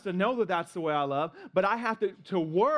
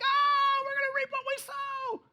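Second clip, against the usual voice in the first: put-on high, shrieking kid terror; mock panic. Kids panic about the worst scripture, high and shrieking. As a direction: squeal it in high mock horror.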